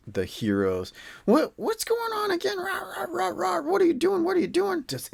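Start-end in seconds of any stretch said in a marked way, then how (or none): 1.3-4.8 high-pitched mock orc scolding; old orcs yelling. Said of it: "they start yelling".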